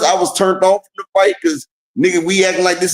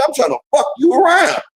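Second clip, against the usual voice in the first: mock shouted threat; 7 semitones higher than usual.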